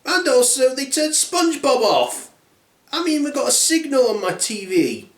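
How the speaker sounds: high-pitched put-out cub; Baby Bear. Acted. Little bear with a broken chair, upset. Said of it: "The Baby Bear then says".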